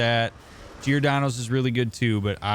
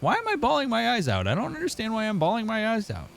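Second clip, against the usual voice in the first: high, bewildered self-quote, much higher pitch; confused inner voice; 12 semitones higher than usual. Retold or imitated. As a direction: ask yourself in baffled disbelief, way up high. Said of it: "I kept thinking to myself".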